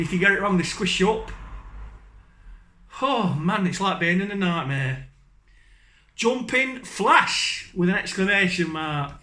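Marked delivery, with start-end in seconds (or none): 6.1-7.7 Jumping Flash high and very slow; slow funny reading. Shown as a demonstration. Reading game names to viewers, high and very slow. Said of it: "in a really funny accent".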